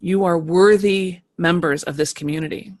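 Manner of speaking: normal talking voice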